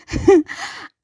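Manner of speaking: a high breathy laugh bursting out; burst of laughter. Real, not acted. Laughing to her listeners at her own awakening. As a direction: laugh out loud at yourself. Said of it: "I'm laughing because".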